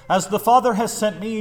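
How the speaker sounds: declaring. Retold or imitated. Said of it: "Jesus declares".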